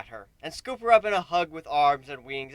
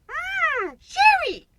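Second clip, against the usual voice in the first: very high, squeaky delighted yum; delighted exclaim; 8 dB louder, much slower than usual. Acted. Pinky licks cake off the princess, delighted squeal. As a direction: squeal over a tasty treat. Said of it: "Pinky exclaimed".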